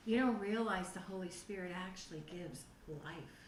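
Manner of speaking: normal talking voice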